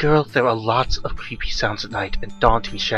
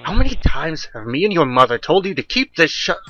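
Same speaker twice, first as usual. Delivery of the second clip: father's higher-pitched telling-off; mild scolding; 6 semitones higher, 3 dB louder than usual. A narrator's reading. Father telling his daughter off for the open window, mildly scolding. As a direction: scold a child with a touch of anger. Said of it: "angry at his scolding Glenda".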